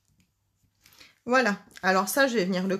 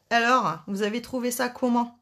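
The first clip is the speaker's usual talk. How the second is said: usual speaking voice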